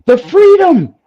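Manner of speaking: excited gush. Real, not acted. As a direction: rave about it, swept away. Raving about van life freedom.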